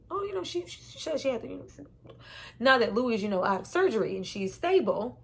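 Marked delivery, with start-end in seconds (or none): 0.1-1.8 evasive reply. Retold or imitated. Evasive answer to child.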